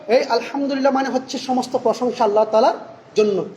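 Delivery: normal talking voice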